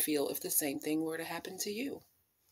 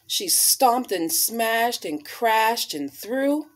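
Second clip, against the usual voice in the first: slow, louder, punched words; emphatic tantrum; 3 semitones higher, 9 dB louder, much slower than usual. Acted. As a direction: stomp through each word. Acting out the tantrum.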